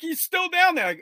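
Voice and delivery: put-on high, small boy voice; scared kid. Boy admitting he left his brother, high guilty voice. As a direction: answer dad like a guilty child. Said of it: "And I'm like".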